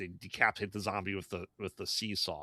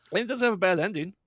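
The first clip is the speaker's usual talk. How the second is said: higher, louder and fast, pushing hard on have; emphatic; 11 semitones higher, 7 dB louder, faster than usual. Real, not acted. Reviewer insisting to co-host the film's ending isn't bad, emphatic and fast. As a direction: blurt it out in a rush. Correcting herself about the ending.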